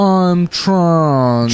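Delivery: lightly put-on old Boston Brahmin voice; mock a posh old Boston accent, drawn out. Mocking Boston Brahmins to friends, posh old Boston accent. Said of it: "They have that accent".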